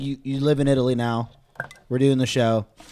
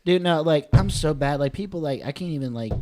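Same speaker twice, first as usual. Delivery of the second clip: ordinary delivery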